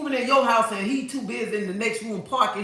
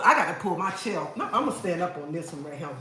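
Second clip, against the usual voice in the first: normal talking voice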